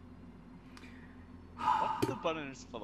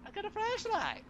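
very high mocking squeal of delight; mocking squeaky voice; 10 dB louder than usual. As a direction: squeal it like a giddy kid. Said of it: "Not like Bastard versus Wave".

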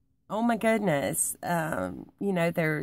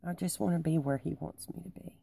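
whispered prayer; 7 dB quieter, faster than usual. Real, not acted. Quiet prayerful wish.

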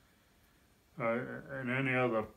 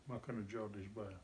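ordinary talk

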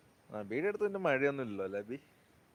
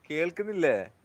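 raised voice to be heard on a bad line; calling louder; 3 semitones higher, 9 dB louder than usual. Real, not acted. Streamer asking viewers if they can hear, louder. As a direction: push it out louder so the stream hears you.

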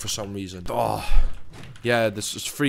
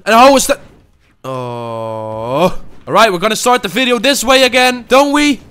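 much higher and louder, hyped; hyped shout; 10 semitones higher, 9 dB louder than usual. Real, not acted. Gamer restarting the video, yelling with hype. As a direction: yell it to pump up the round.

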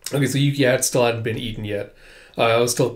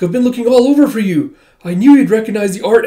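high, loud and fast; excited rush; 8 semitones higher, 7 dB louder, faster than usual. Acted. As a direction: tumble the words out in relief. Excited reunion with lost friend.

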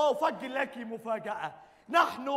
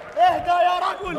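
louder call to calm; loud plea; 4 semitones higher, 8 dB louder than usual. Acted. Comrade urging the angry man to calm down, loud. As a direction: urge him loudly to settle. Calming a scuffle.

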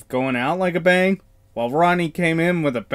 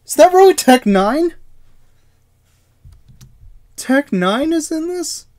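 surprised; 9 semitones higher, 3 dB louder than usual. Real, not acted. Reacting to a surprise cameo.

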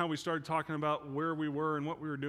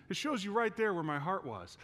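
ordinary talk

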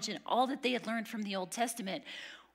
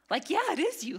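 higher, louder excited yeah; eager realization; 8 semitones higher, 7 dB louder than usual. Acted. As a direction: burst out with delighted recognition.

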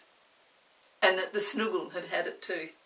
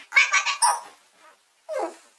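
winded quacks; 9 semitones higher, 13 dB louder than usual. Acted. Snuggle kicked, sits down.

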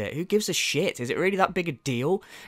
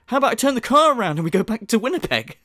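higher mock threat to quit; mocking impression; 8 semitones higher, 3 dB louder, faster than usual. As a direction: put on a high-pitched petulant voice. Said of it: "he is literally like".

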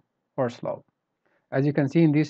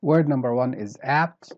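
ordinary delivery

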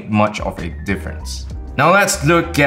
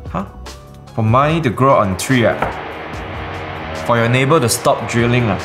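Singlish; 3 semitones higher than usual. Acted. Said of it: "bangsawan like me".

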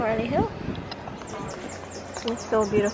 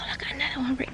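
hushed, quick whisper of wonder; 4 semitones higher, 10 dB quieter, much faster than usual. Real, not acted. Parent pointing out a tortoise to her toddler, whispered. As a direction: breathe it out fast so nothing startles.

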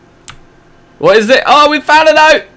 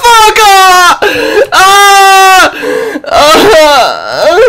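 high, loud scream in real fright; terrified scream; 14 semitones higher, 5 dB louder, much slower than usual. Real, not acted. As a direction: shriek at the jump scare. Jump scare in game.

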